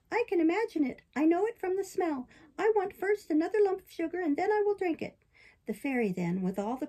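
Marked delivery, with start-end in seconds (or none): 0.1-5.2 high-pitched puppet protest; balky puppet. Acted. Puppet refusing the fairy's bitter drink, petulant. Puppet argues for more sugar.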